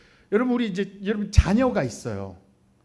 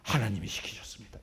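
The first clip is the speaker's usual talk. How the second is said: pious claim; 11 semitones lower, 10 dB quieter than usual. Retold or imitated. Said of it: "이 표현이 좀 그런가".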